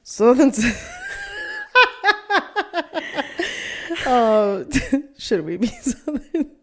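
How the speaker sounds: high giggling through the words; laughing. Real, not acted. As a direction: laugh while trying to talk. Cracking up over the accent.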